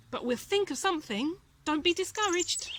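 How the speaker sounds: a cheerful mouse promising to help; plucky circus mouse. A narrator's reading. Timothy voice.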